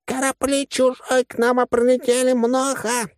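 high, slow, silly machine voice; crazed loudspeaker alarm. A narrator's reading. Loudspeaker reports alien ships to the crew, crazed voice. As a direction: squawk the broken report up high. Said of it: "заорал динамик дурным голосом".

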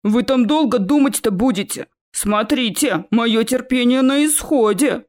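troll voice warning through the door; impatient troll. A narrator's reading. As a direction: warn them, patience running out. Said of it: "подал голос тролль". (нетерпеливый голос тролля)

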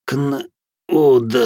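high, broken-up croak; hoarse croak. A narrator's reading. Weakened captive answers his interrogator, croaking. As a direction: choke the word out in pieces. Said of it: "прохрипел пленник".

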